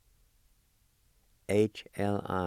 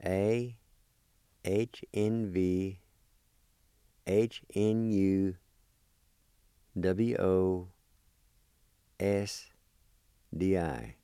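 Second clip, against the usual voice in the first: slow spelling; much slower than usual. Shown as a demonstration. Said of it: "spelled like this".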